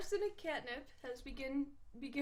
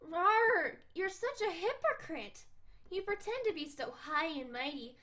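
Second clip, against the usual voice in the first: playful accusing rawr; 3 semitones higher than usual. Acted. Cat girl calling the stuffy troll a hypocrite, playful. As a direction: pounce on him with the accusation.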